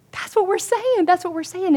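very high, eager insistence; acted squeal of confirmation; retold excited agreement. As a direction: imitate friends urging yes, exactly.

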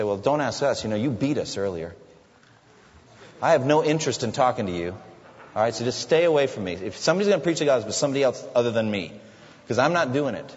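mock-harsh refusal, rattled off quickly; harsh, mean answer. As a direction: snap it out like you want nothing to do with him. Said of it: "That's a harsh, mean answer".

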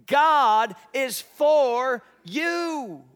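loud, slow call for the room to repeat; leading the congregation. Acted. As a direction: lead the crowd in a big shout. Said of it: "Say it with me".

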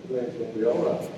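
low, quick, unbothered reassurance; calm reassurance. Retold or imitated. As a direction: drop right down to an easy, soothing tone. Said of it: "to this".

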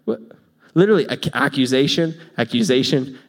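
normal talking voice

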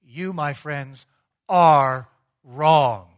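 slow "You, my friends", then a loud hit on "are wrong"; booming flat rebuke; emphatic, unapologetic. Retold or imitated. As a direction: lay down the verdict without apology. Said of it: "states it emphatically, unapologetically".

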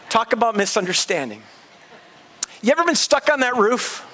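usual speaking voice

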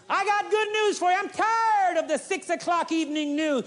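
full-force preaching. Acted. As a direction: preach it out with fire.

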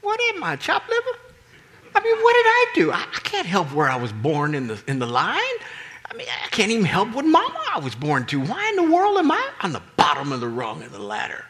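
high whining complaint, laughing edge; whiny brothers. Retold or imitated. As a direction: play the jealous brother whining. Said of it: "all the other brothers said".

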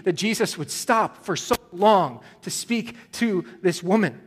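impassioned preacher voice; preaching at full force. Acted. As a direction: drive the tension home to the congregation. Preaching Jairus's desperation.

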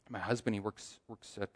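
low, soft retold admission; hushed, understated retelling; serious confession. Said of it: "the conversation gets serious".

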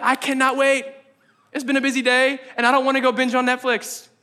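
high-pitched, can't-wait eagerness; acted-out keen delight; eager anticipation. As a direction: gush like someone itching to begin. Acts out eagerness for prayer.

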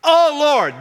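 crying out. Acted. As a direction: cry out to God, high and urgent. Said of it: "you cry".

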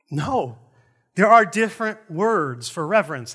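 ordinary talk, nothing marked

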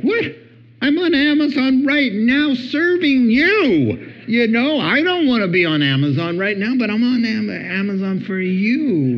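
high, indignant acted protest; hammed-up wounded husband, voice shooting up and stretched out; offended. Misunderstood husband protesting to wife, offended. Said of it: "now he's offended".

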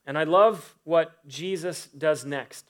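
ordinary talk, nothing marked

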